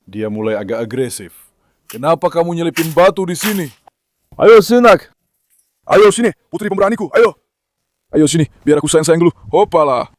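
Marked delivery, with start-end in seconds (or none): 4.4-7.4 loud high call to come; loud eager call to the dog. Real, not acted. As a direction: call her over with glee. Calling dog over excitedly.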